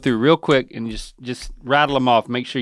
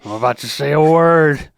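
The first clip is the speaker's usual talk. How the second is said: mocking Dad's nervous run-up. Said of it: "It's like he's jumping off a cliff".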